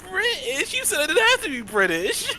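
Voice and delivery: high, loud disbelieving protest; wait, what? you never said that; indignant outburst. Real, not acted. Player protests accent demand.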